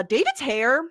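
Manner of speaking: usual speaking voice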